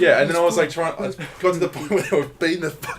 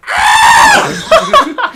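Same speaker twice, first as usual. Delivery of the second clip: very high, loud shriek; full-force mock screech of an incoming bird; sudden piercing scream; imitated bird screech; 15 dB louder than usual. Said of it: "and just went".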